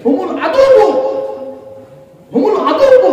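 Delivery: loud high cry of হুমুল আদুউ; fervent proclamation. Acted. Preacher crying out the verse to the crowd, loud and high. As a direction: ring out the verse at full voice.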